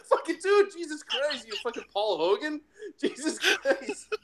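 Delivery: high, incredulous laughing reaction; laughing disbelief. Retold or imitated. Viewer mocking the mobster's accent, laughing disbelief. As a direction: crack up in exasperated disbelief.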